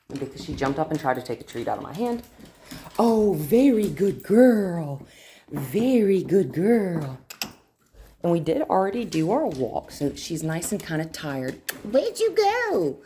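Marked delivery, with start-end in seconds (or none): 3.0-7.2 slow, high, louder sing-song praise to the dog; drawn-out praise. Real, not acted. Trainer praising her dog Brandy, slow high sing-song. 11.8-13.0 high playful call. Real, not acted. Calling dog playfully.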